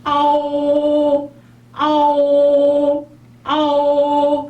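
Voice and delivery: 아오 stretched slowly, high and loud; long drawn 아오. Shown as a demonstration. Teacher modelling a slow 아오. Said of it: "비율이 거의 비슷해요".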